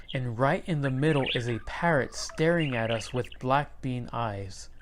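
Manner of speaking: ordinary delivery, nothing marked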